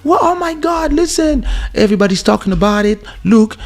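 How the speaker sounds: high, urgent pleading voice; panicked PR man. Retold or imitated. PR man warning the sleepy artist, high and frantic. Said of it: "He's like".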